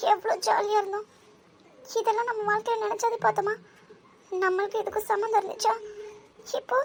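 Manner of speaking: high, distressed appeal; upset pleading. Acted.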